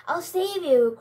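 loud, high rallying shout; heroic cry. Acted. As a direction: call out bravely to the rescue. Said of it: "cried the brave knight".

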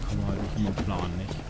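ordinary delivery

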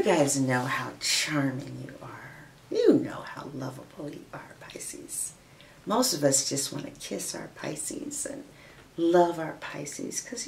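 soft unvoiced whisper to Pisces viewers. Reader whispering to Pisces viewers, soft whisper. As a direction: lean in and whisper it like a secret.

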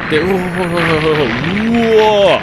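real loud high whoa; startled yell. Real, not acted. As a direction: yelp as the ground gives. Sliding down slope.

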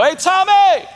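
high, loud friendly shout; calling out to a friend. Retold or imitated. Disciples calling out to Thomas, loud and high. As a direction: hail your buddy across the room. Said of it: "they come to Thomas and they go".

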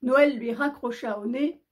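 usual speaking voice

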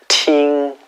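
"팅" held high and level; first tone. Shown as a demonstration. Teacher demonstrating the word "팅" to students. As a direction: say "팅" flat and high. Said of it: "팅 하고".

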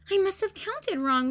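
high, puzzled sister voice; puzzled Ruby. A narrator's reading. Sister Ruby puzzles over her miscounted guests. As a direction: wonder aloud at the count. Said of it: "said Ruby".

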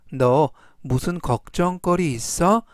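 ordinary talk, nothing marked

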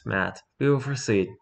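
ordinary talk, nothing marked

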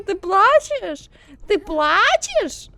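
slow, high and loud teasing; loud sing-song mock; mocking taunt. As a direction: drag out the mockery slowly. Taunting crying child in game.

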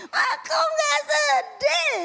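high, loud sobbing protest; crying child. Pretend. Child crying back at mother, loud high sob. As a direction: wail it like a hurt toddler.

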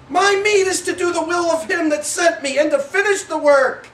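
excited reading. Shown as a demonstration. Jesus answering the disciples, eager and excited. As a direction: rush it out in one eager run. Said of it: "He was excited".

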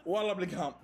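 normal talking voice

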